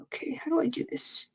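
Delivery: hushed whisper while fiddling with settings; whisper to self. Real, not acted. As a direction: breathe it under the breath, confused. Muttering to self at stream software.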